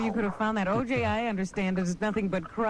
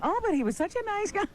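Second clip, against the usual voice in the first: mock bystander; 11 semitones higher than usual. Mock quote of shocked bystanders.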